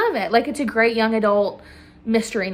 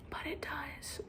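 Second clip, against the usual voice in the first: hushed ominous whisper; whispered dark aside to viewers; 5 semitones higher, 21 dB quieter than usual. Real, not acted. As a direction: drop to a breathy whisper for the twist. Ominous aside about the death.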